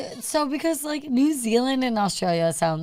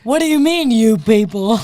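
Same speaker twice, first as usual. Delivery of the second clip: mock offended; 3 semitones higher, 9 dB louder than usual. Playing an offended audience member, mock outraged shout. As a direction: throw it back, hammed-up affront.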